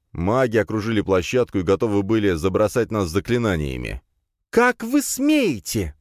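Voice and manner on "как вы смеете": high, drawn-out indignant outburst; angry snarl. A narrator's reading. Princess to threatening mages, angry snarl. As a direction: stretch it out in outrage. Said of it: "зарычала на них Надя".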